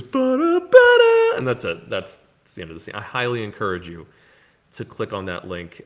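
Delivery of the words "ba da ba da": high, loud vocal "ba-da, ba-da"; imitated sung sting. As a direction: belt out a mock fanfare ending, rising. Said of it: "and just goes".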